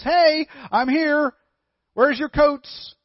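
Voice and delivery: high, slow, pleased-with-himself donkey voice; cocky donkey. Acted. Vain donkey calling to the townspeople, high and slow. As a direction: puff up like a vain little donkey. Donkey demands attention at well.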